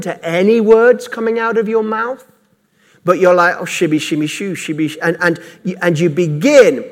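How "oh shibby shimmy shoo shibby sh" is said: soft mimicked fake tongues, lower and quieter; half-hearted made-up syllables; mock tongues babble. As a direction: parody of rote babbling. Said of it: "to mimic".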